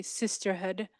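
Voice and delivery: usual speaking voice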